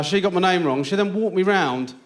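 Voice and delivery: normal talking voice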